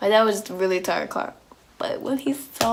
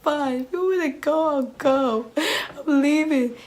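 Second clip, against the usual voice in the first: higher, very slow, tearful give-up; tearful defiance; 6 semitones higher, slower than usual. Retold or imitated. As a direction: cry it out while storming off. Crying daughter answers dad.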